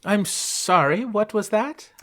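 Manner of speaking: high, slow, affronted put-on voice; polite lady character. Miss Buffin answers a player, high put-on voice.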